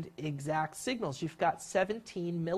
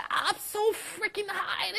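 much higher, slowed and giddy; thrilled squeal; 14 semitones higher, slower than usual. Acted. Rider at the top of the coaster, giddy squeal.

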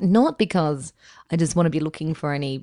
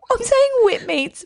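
laughing; 4 dB louder than usual. Real, not acted. Laughing at own phrase.

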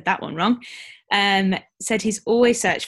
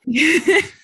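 burst of laughter; 10 semitones higher, 10 dB louder than usual. Real, not acted. Laughing at the French accent.

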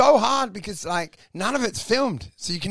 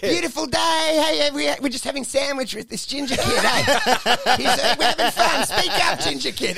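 schmooze the teacher while hiding the bullying; fake-polite bully; 6 semitones higher than usual. Imitating a bully sweet-talking the teacher. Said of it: "they're like".

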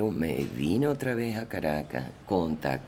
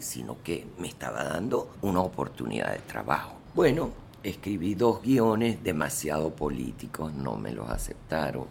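ordinary talk, nothing marked

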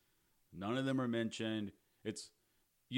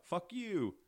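high mock defiance; retold rude kid; 9 semitones higher than usual. Kid rejecting dad's fix-it plan again, mock defiant. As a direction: imitate a bratty kid swearing at dad. Acting the kid's repeated rude reply.

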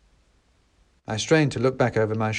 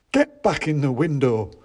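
louder, a touch of a cross little shopkeeper; mild shopkeeper order; 4 semitones higher, 4 dB louder than usual. A narrator's reading. Shopkeeper ordering soldiers back, mildly cross. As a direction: scold the toys back into place.

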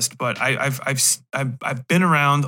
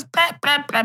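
mock mother blather; 12 semitones higher than usual. Trailing the mocked mother.

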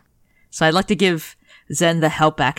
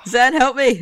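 much higher mock plea for help; 10 semitones higher, 3 dB louder than usual. Real, not acted. Player begging a teammate after a bad roll, mock plea. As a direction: whine for rescue in fun.

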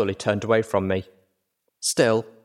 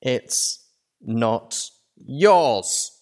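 very slow, drawn-out filly voice, louder; cold filly voice; 4 semitones higher, 3 dB louder, much slower than usual. Acted. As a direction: drag each word out, possessive. Filly confronts narrator.

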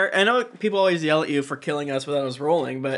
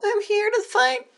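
squeaky high voice; slower than usual. Acted. Player squeaks a defiant reply.

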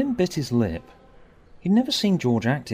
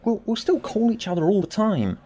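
defiant; 11 semitones higher, 3 dB louder than usual. A narrator's reading. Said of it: "Tim said defiantly".

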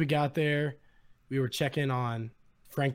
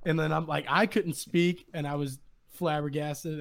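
usual speaking voice

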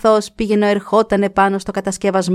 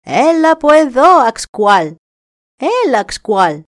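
high loud coaxing call; coaxing raven; 7 semitones higher, 6 dB louder, slower than usual. Acted. Raven luring the deer over, loud. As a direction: lure him in sweetly.

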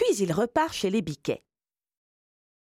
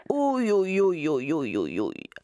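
wolf groaning; 3 semitones lower than usual. A narrator's reading. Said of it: "se frotte le ventre et soupire".

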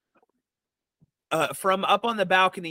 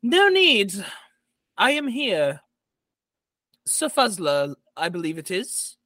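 lady of the house; 2 semitones higher than usual. Acted. Jorhana arriving to greet Sir Fuzzler, stately lady's voice. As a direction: sweep in as the stately hostess. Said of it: "and she says".

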